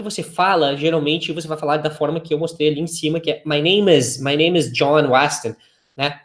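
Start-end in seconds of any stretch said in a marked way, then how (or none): 3.4-5.5 "My name is" run together quickly as one word; faster and natural. Shown as a demonstration. Teacher shows how people really say it, fast and linked. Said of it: "Você vai falar um pouco mais rápido".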